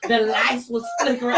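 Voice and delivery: usual speaking voice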